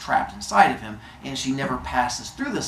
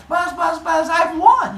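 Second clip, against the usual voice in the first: very high, loud buzzing gnat voice; buzzing gnat taunt; 7 dB louder, slower than usual. Retold or imitated. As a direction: buzz it out high and shout the win. Goddess as gnat taunting Zeus.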